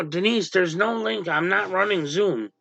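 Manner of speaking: ordinary delivery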